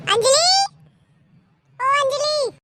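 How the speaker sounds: loud, high summons; calling out. Acted. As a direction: shout the name to fetch your wife. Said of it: "अभी बुलाता हूँ इस अंजलि को". (ज़ोर से ऊँची आवाज़ में पुकार)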